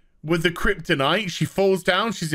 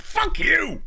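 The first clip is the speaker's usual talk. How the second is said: fuck you fired off much higher; mock outburst; 11 semitones higher than usual. Real, not acted. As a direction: snap back at the imagined fan, pitched way up. Ranting reply to imagined fans.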